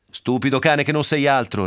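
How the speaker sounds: a furious master snarling at his dog. Acted. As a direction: snarl it through rage. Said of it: "ringhiò".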